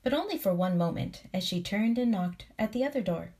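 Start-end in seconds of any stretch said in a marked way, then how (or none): none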